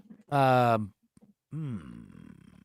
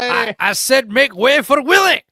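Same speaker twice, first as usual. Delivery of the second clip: high loud shout; excited shout; 9 semitones higher, 11 dB louder than usual. Pretend. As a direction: bellow the catchphrase. Shouting a character catchphrase.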